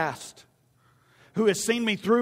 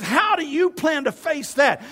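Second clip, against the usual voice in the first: loud, high, drawn-out challenge; challenging Saul; 7 semitones higher, 5 dB louder than usual. Acted. Saul questioning David about the giant, loud challenge. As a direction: shout the question in disbelief. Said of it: "Saul looks at him and says".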